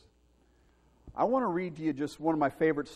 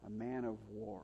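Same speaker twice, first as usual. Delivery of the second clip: "A man of war" said much softer, lower, in awe; awed hush; 5 semitones lower, 14 dB quieter than usual. Shown as a demonstration. Preacher to congregation, repeating in awed quiet. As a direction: let "war" sink in quietly.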